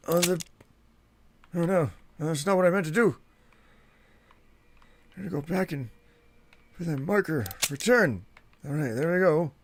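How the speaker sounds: put-on a cranky old-timer grumbling about youngsters; Old Man Willie voice. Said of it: "appropriate for Old Man Willie voice".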